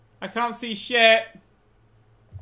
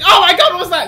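much higher, much louder panicked cry; startled yelp; 10 semitones higher, 13 dB louder, faster than usual. Real, not acted. Player startled by a shadow, loud high yelp. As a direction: jump at the sudden shape.

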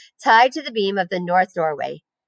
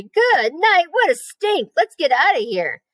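put-on boy screaming at a rotten smell; disgusted scream; 8 semitones higher, slower than usual. A narrator's reading. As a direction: yell it out in revulsion. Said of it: "screamed Avery".

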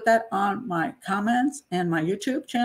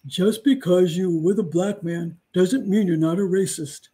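mocking accuser. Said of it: "I've had these two charges before".

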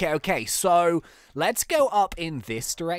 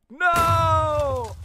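long, loud, high cry; hammed-up anguished howl; melodramatic scream; 11 semitones higher, 6 dB louder than usual. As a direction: scream in mock horror at a death. Captive is killed.

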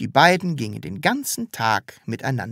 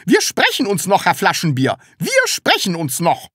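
high, raised-voice threat; threatening; 7 semitones higher, 5 dB louder than usual. A narrator's reading. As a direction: threaten indignantly, repeating it for effect. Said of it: "drohte die Rotkohl". (empörte, laute Drohung)

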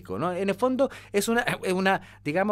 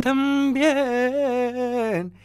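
airy voice; 4 semitones higher than usual. Shown as a demonstration. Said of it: "pura voz con aire".

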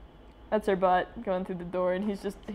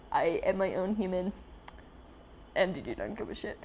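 normal talking voice

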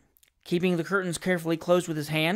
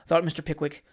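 ordinary delivery

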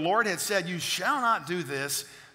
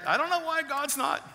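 much higher, mock complaining voice; mocking whiny complainer; 10 semitones higher than usual. Preacher mocking church members who blame God, in a high whine. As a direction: parody a doubter who whines about God. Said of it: "Heard that?".

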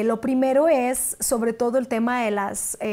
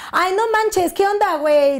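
high, loud Mexican slang put-on; mock slangy greeting; 9 semitones higher, 5 dB louder than usual. Actress imagining greeting a producer in slang, mock-casual. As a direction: play at being too casual, pitched way up.

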